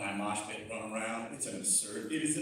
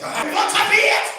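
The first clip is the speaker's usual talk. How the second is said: high, loud eager cry; mock shout; 13 semitones higher, 13 dB louder than usual. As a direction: bellow it like an overeager fan.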